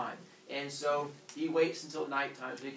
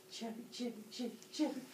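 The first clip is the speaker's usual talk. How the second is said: high, soft chopping chant; small high cartoon chop sounds; miniature chopping; 9 semitones higher, 7 dB quieter than usual. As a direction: squeak it quietly with each jab. Imitates Kronos's repeated chops.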